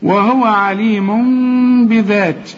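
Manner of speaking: slow, measured tajweed chant; Quran recitation. Shown as a demonstration. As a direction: recite slowly with a long nasal hum in عليم. Recites verse phrase as demonstration. (تلاوة مرتلة بإقلاب)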